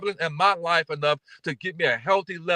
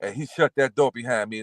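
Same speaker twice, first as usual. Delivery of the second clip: usual speaking voice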